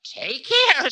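very high, loud, sharp voice; sharp warning. A narrator's reading. Time warns the mocking Ravana, sharp high cry. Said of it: "said Time sharply".

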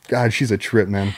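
normal talking voice

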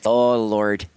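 a hint of a Canadian praying woman; Canadian accent. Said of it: "she had the Canadian accent".

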